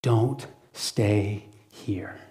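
soft, slow whisper; hushed urging. Retold or imitated. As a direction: lean in and whisper the plea. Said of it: "he would say".

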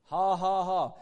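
measured, put-on ha-ha-ha; imitated laugh with no amusement in it; forced laugh. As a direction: fake a laugh one ha at a time. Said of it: "He said he just put it on".

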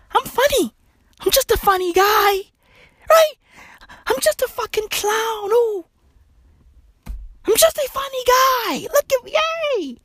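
high, goofy self-mocking voice; silly clown persona. Comic mocking himself as a harmless clown, high goofy. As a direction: play the harmless funny guy, lightly. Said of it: "Me, on the other hand".

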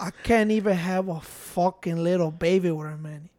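low, quiet movie-gangster impression; a lightly voiced drug lord, trailing off soft; Tony Montana. Acting out a classic movie scene for friends, Tony Montana voice. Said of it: "everybody try to do, uh, Tony's voice".

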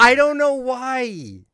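high, drawn-out protest; exasperated whine. Real, not acted. Teased streamer protests about his odd word, exasperated whine. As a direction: stretch it out slowly and high, helpless.

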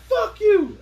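high, loud mock yell; hammed-up furious retort; imitated angry girl. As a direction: snap back as the offended girl. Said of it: "she was like".

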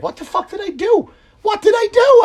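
high panicked yelp of the fired guy; bewildered fired worker. Retold or imitated. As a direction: squeal in shocked disbelief.

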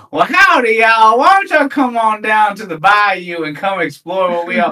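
high, loud Louisiana tourism pitch; overly eccentric lady. Retold or imitated. Lady inviting visitors to the bayou, loud and eccentric. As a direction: holler it like an over-eager ad hostess. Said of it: "just her just being overly eccentric".

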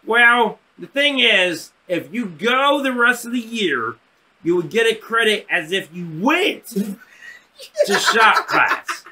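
high froggy voice, slowing toward the end; Kermit the Frog. Retold or imitated. Counselor explaining the credit deal, froggy voice. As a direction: drag out the deal like an earnest puppet frog. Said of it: "This guy sounded like Kermit the Frog".

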